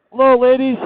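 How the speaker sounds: bright sing-song hello to the birds; high playful greeting. Real, not acted. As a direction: call out high and cheerful. Greeting turkeys by the road.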